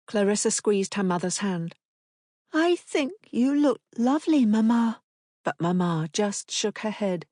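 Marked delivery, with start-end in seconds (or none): 2.5-5.0 put-on high, gentle child voice; a small girl consoling her mother; comforting little girl. A narrator's reading. Daughter comforting Mamma, high and gentle.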